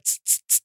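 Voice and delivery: sharp little hissed "tss" puffs; short, sibilant pump noises; imitated cuff pumping. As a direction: mimic a blood pressure cuff inflating. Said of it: "go around your arm and go".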